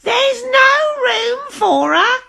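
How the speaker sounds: high, loud, slow witch shout. A narrator's reading. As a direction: yell a warning in a witchy voice. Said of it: "shouted the friendly witch".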